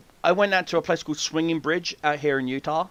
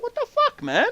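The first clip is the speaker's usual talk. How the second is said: much higher indignant squeak. Retold or imitated. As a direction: squeak it out, offended. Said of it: "I'm like".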